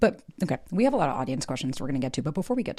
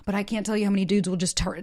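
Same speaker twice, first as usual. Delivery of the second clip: ordinary delivery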